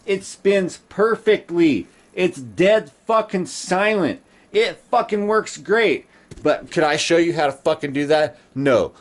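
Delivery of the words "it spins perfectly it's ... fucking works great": higher, slow and savouring every word; proud excitement. Real, not acted. Proudly showing viewers the finished bike, slow and delighted. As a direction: show off the bike you just fixed, delighted.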